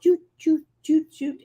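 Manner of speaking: high-pitched little "choo" with each swing; imitated pendulum swing. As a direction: make light, high swinging noises.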